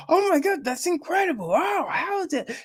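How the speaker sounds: high, hammed-up amazement; mock gushing. Mocking movie marketers gushing over bad ideas, high and fake. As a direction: gush like a starstruck fan, way up high.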